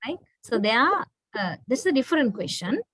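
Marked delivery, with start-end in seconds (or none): none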